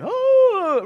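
high, loud drawn-out "oh"; swooping, exaggerated cry of "oh"; loud mock wail. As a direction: wail a long, high "oh" at full voice. Imitates reaction to sin's critter.

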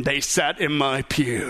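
low, drawn-out mock complaint; mock petty grievance. Preacher mimicking a churchgoer's pew complaint, slow mock grievance. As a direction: stretch each word as a hammed-up grumble. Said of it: "I'm talking more serious, believe it or not, than".

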